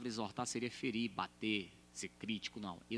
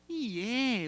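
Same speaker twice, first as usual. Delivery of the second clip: high, louder surprised question; surprised vendor; 12 semitones higher, 6 dB louder than usual. Retold or imitated. Market woman surprised the pastor loves the Northeast, high and bright. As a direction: sound pleasantly surprised.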